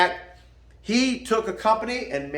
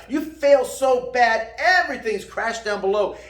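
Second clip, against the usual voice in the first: ordinary delivery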